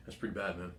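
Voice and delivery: a hint of an unamused coach mumbling; dry, humorless mutter; flat deadpan coach. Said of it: "he's like".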